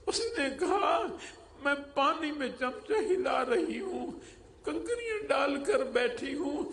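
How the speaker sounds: put-on a mother half-sobbing over her hungry children, pitched high; weeping widow. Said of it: "وہ رونے لگ گئی". (روتی ہوئی ماں کی اونچی، درد بھری آواز)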